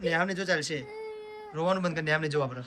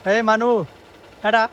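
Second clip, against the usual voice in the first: calling out; 8 semitones higher, 8 dB louder than usual. Real, not acted. Vlogger hailing little Manu, calling out high and loud. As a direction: call the little one's name loudly.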